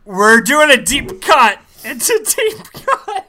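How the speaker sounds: loud, laughing defence of a niche pick; laughing insistence. Real, not acted.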